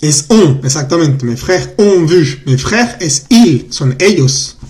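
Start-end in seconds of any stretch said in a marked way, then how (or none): 1.2-3.0 slow model sentence. Shown as a demonstration. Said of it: "teacher models the sentence slowly".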